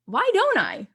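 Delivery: mock lightbulb moment, voice jumping up; sudden realization. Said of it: "I'm like".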